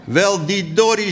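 lightly voiced emotion, drawn out; touched, slightly sniffly storytelling voice; a little choked up. A narrator's reading. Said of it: "een beetje snofferig van alteratie".

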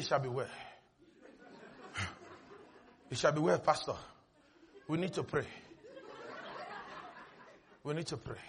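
very low, hushed despairing voice; acted feeble, hopeless murmur; defeated believer. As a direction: barely voice it, drained of hope. Acted crushed believer after medical report.

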